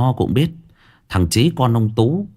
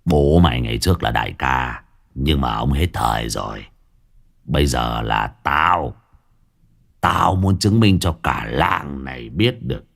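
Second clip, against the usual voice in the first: a swaggering village thug boss, scornful; lightly voiced boss, sneering at old Tú; 6 semitones lower than usual. Gang boss mocking old Tú to his underlings, scornful. Long boasts he rules now.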